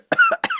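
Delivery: sharp coughing; choking cough. Real, not acted. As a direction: cough on something swallowed. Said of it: "I swallowed something the wrong way".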